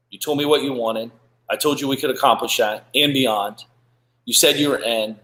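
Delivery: ordinary delivery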